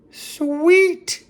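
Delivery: high, loud sarcastic cheer; mock delight. Mocking his old self with a sarcastic high cheer. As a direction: crow it with fake glee.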